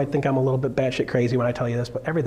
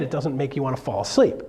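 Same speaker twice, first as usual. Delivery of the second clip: ordinary talk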